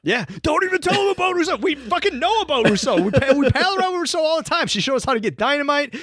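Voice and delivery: high, fast, heated mock outburst; mock indignant rant. Podcaster ranting at Aldo on the survivors' behalf, high and heated.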